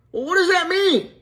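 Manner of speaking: very high acted child's question; puzzled little girl. Little Susie asking her dad, puzzled. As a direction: ask squeakily as little Susie.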